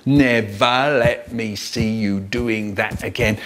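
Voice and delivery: low, slow, every word a poke; scolding dad. Acted. Dad poking his son while scolding, stern. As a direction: scold in time with a jabbing finger. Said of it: "poking me with his finger in time with the words".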